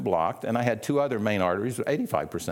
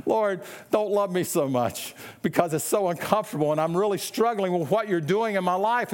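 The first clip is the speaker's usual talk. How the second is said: whiny complaining believer; 5 semitones higher than usual. Retold or imitated.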